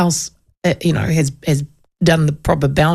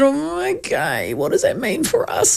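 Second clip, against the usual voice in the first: mock innocent; 7 semitones higher, 4 dB quieter than usual. Host retells feigning innocence to the boundary man. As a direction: play dumb gently.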